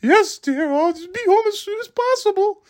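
high, meek, simpering husband voice; meek henpecked husband. Retold or imitated. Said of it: "he starts imitating me".

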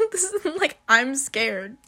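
real laughing disbelief; laughing exclamation. Real, not acted. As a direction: crack up over the panic. Laughing retelling the fright.

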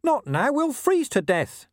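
high, pleading little dog whine; small dog begging his master to wake, high whine; whining. A narrator's reading. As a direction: whine it like a worried mutt. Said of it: "Gaspode whined".

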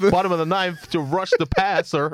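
bluffing sports commentator voice; mock commentary. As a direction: ham up a clueless pundit.

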